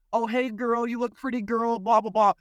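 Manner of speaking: mock friendly girl talk. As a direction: parody girls complimenting each other. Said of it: "women are all on the same team".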